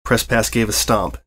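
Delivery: plain reading voice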